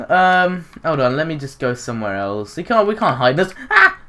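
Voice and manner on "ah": a sudden high shriek; startled shriek. Real, not acted. Gamer spotted by a seeker, startled shriek. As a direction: yelp as if caught.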